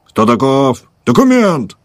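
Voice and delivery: high loud bark on Документ; soldier shouting for papers. Acted. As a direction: snap out the demand.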